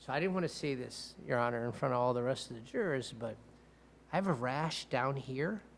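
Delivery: soft, deadpan imitation of an awkward admission; dead serious. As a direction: retell it quietly with a straight face. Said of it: "he was dead serious".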